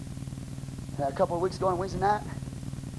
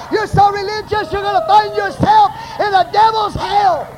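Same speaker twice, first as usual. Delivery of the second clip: higher, louder cry on "so", pushing into a shout; shouted rebuke; 13 semitones higher, 12 dB louder, slower than usual. Acted. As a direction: warn the church at full voice. Preacher rebuking the congregation.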